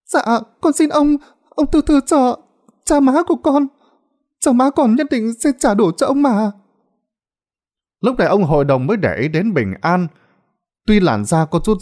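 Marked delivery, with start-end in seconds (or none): 0.1-6.5 put-on much higher young girl begging; a lightly voiced teenage girl pleading for her parents. A narrator's reading. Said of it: "cô nài nỉ".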